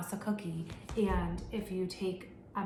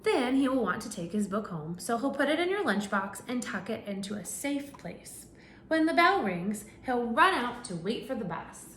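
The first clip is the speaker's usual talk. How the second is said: animated picture-book narration; reading to children; 2 semitones higher than usual. Acted. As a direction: read it out to a class of little kids. Reads picture book to class.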